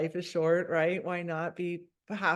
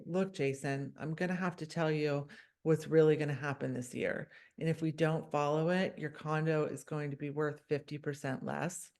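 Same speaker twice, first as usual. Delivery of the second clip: low, soft and measured; late-night DJ voice; 4 semitones lower, 5 dB quieter than usual. Shown as a demonstration. Board member telling Jason what will really happen, late-night DJ voice. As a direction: drop it low and soft to make the point. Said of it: "I do use the late night D J voice".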